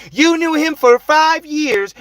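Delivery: loud, high, drawn-out mimicry; exaggerated mock-outraged imitation; mocking quote. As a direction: throw the question back high, slow and loud. Mocking her stupid question.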